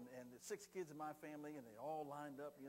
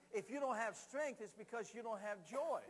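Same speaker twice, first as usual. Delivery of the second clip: higher, louder, punchy declaration; emphatic preaching; 4 semitones higher, 5 dB louder than usual. Real, not acted. Preacher linking strength and joy, loud emphatic declaration. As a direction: hit strength and joy hard.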